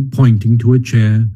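usual speaking voice

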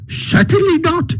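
very high, emphatic Toad voice. A narrator's reading. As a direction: Toad flatly refusing, up high. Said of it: "replied Toad emphatically".

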